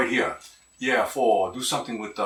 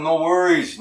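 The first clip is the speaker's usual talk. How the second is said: usual speaking voice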